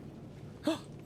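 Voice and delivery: sharp gasp. Real, not acted. Gasp at the book.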